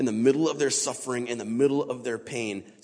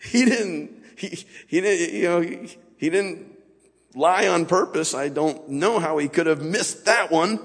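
ordinary talk, nothing marked

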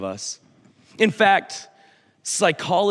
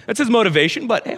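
usual speaking voice

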